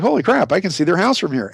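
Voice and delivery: high thrilled exclamation; amazed. Retold or imitated. Spotting the base from the hilltop, high amazed cry. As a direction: wow at the view. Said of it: "I was like".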